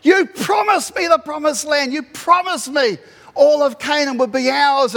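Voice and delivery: high, loud cry to God; anguished plea. Acted. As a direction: plead in pain, acted out at full voice. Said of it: "comes to God in tears and pain".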